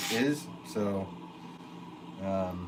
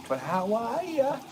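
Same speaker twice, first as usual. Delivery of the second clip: slow, much higher Boston accent greeting; 13 semitones higher, slower than usual. Shown as a demonstration. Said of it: "Boston accent sometimes".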